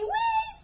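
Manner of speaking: high squeaky bird "wee"; bird chirp. A narrator's reading. The bird chirping in Ava's ear, squeaky high chirp.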